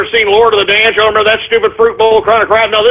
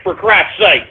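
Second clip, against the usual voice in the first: usual speaking voice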